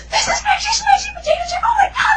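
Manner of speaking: very high, loud squeal; high-pitched fangirl-style freak-out; imitated freak-out. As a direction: shriek it in a mock panic. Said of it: "I'm, like, freaking out".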